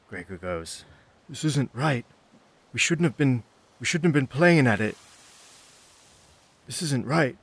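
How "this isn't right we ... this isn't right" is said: higher-pitched acted protest; bickering hesitant farm boy. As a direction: object anxiously to your friends. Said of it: "They begin to bicker amongst themselves".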